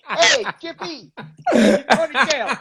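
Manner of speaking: a lightly voiced cop hauling someone off; Republican cop. Comic cop barking at a kid in Jordans. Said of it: "that side of me is like".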